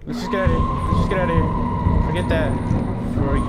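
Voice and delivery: loud, urgent get-me-out yelling; panicked yelling. Real, not acted. Gamer spooked by a monster, yelling loud to escape. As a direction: yell it like you want out now.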